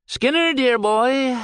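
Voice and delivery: high, slow, honeyed purr; over-sweet amiable purr; purring amiably. A narrator's reading. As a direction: sweet-talk him like a cat purring. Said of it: "Cappy purred amiably".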